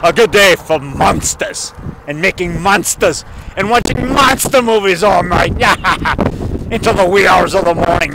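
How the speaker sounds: hammed-up ghoulish voice with cackles; gleeful horror host. Horror fan planning a night of monster movies, playfully ghoulish. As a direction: play a mock-evil monster-show host, cackling between phrases.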